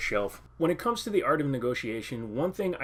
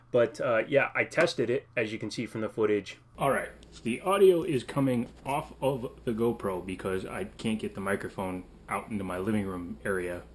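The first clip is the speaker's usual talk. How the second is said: ordinary talk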